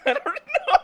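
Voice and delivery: high, loud real laugh over words; real giggly I don't know. Real, not acted. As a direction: laugh out the answer. Laughing at the joke.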